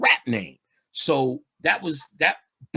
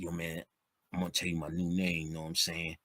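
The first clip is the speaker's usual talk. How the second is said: hushed street talk, sharing a secret; low confiding voice; 7 semitones lower, 11 dB quieter, faster than usual. Retold or imitated. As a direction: lower and softer, imitating a friend leaning in. Friend announcing new name.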